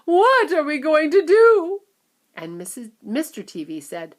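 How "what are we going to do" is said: high, loud slow despair; helpless lament; wailing. A narrator's reading. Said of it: "wailed Missus Teavee".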